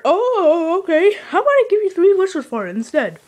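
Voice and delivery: lightly voiced little leprechaun. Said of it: "You sound like a little leprechaun".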